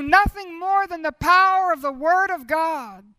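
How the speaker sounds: preaching at full force. Acted. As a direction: drive it home to the people. Preaching the resurrection power.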